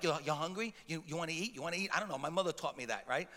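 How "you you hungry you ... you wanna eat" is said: low, soft mock offering of food; hushed, lightly acted host. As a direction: quietly press food on a guest. Imitates himself offering workers food.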